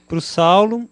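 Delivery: ordinary talk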